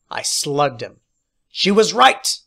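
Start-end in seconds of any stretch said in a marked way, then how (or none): 1.5-2.4 higher, loud yell. A narrator's reading. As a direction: yell it in the fight. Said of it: "I yelled".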